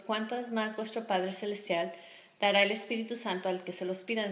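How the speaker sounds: preaching scripture. Acted. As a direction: proclaim the verse to the church. Reading scripture at full force.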